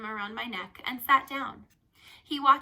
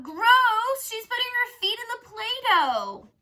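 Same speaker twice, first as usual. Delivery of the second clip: put-on high, loud childish outcry; kid crying out; 13 semitones higher, 10 dB louder, slower than usual. Girl retelling a museum kid's outcry, mock yell. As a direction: shriek it like a grossed-out little kid. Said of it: "this one kid cried out".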